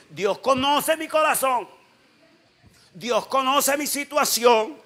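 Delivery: high, loud, slow imitated protest; mock protesting member. Pastor imitating a member excusing himself, loud mock protest. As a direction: cry it out like an indignant excuse-maker. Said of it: "Hay uno que dice".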